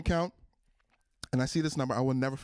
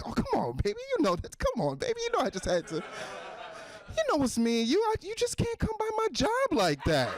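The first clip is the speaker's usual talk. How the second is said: high, squeaky mock pleading; wheedling boyfriend. Cheater soothing his side chick, high wheedling. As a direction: coax a lover in a very high voice.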